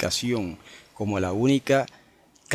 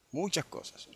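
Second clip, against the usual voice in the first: muchas cosas repeated much softer; soft echo; 4 semitones higher, 11 dB quieter than usual. Real, not acted. Preacher to congregation, softly echoing muchas cosas. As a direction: let muchas cosas fall to a near-murmur. Preacher echoes phrase for emphasis.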